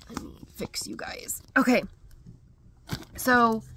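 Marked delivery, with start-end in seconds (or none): 0.1-1.4 whispered aside. Real, not acted. Quick aside while fixing camera.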